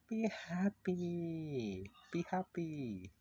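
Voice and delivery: high, slow, stretched-out coaxing. Acted. Coaxing the gloomy character to cheer up, high drawn-out plea. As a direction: draw it out high and soothing.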